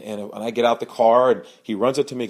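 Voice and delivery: ordinary talk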